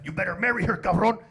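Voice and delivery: loud, menacing Mexican dad; acted angry dad's ultimatum; threatening father-in-law. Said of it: "her dad came up to me like".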